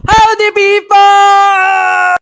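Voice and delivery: loud, drawn-out howdy; loud greeting. Real, not acted. Vlogger greets viewers, loud and slow.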